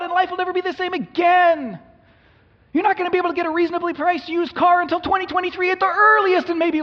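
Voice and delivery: hysterical high, loud parody of scary news; mock alarmist newscaster. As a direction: shriek the headlines like panicky news.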